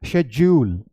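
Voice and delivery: शेड्यूल said "SHEJ-yool"; British "schedule". Shown as a demonstration. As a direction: say शेड्यूल with sh and a j sound. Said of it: "British English में इसे बोला जाता है". (शेड्यूल का British उच्चारण "शेज्यूल")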